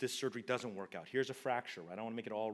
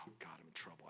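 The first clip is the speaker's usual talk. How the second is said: a hushed, half-joking "I'm in trouble"; dread whisper; 7 semitones lower, 15 dB quieter than usual. Retold or imitated. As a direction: whisper it in mock panic. Dreading the CFO's call.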